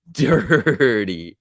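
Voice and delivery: loud, stretched-out dirty; gleeful dirty. Real, not acted. Trader gloating over a great trade, loud gleeful dirty. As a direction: relish the word.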